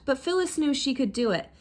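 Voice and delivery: ordinary delivery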